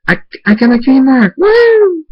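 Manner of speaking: high, loud shout of joy; thrilled shout. Acted. Abel discovering his cutie mark, shouting with joy. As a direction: burst out with delight. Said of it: "lights up and says".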